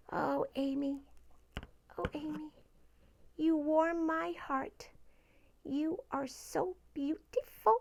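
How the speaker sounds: soft, cooing affection; tender adoration. Pretend. [name] gushes softly to Amy the guinea pig, tender. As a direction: coo gently to a beloved pet.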